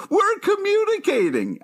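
very high mock yell at normal volume; high-pitched imitation of a shout; retold yell. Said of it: "she literally yells".

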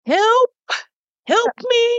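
mock desperate "help me"; mock plea for help. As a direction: imitate a helpless husband begging.

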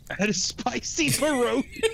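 mock the line while cracking up; laughing mockery. Friend parroting the pierogi excuse, laughing. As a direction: repeat it high through a laugh.

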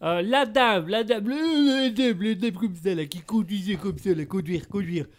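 broken French in a thick fake Italian accent. Examiner explains the old lady in fake Italian accent. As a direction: mangle the words like an Italian tourist. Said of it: "tu fais vachement bien l'accent italien".

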